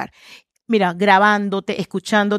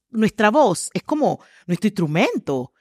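ordinary talk, nothing marked

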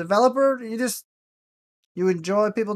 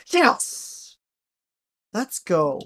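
triumphant; 2 semitones higher, much slower than usual. Real, not acted. Guessed right in game.

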